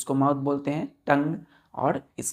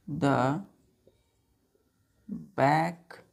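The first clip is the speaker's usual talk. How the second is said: word by word, with long pauses; measured, drawn-out classroom dictation; slow dictation; 2 semitones lower, much slower than usual. Real, not acted. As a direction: dictate slowly for pupils writing it down. Dictates the definition while writing.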